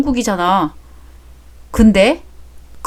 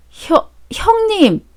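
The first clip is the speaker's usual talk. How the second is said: slow, high startled call out; stunned, calling out to sister-in-law; high drawn-out shocked call; shocked cry; 9 semitones higher, much slower than usual. Acted. Shocked realization.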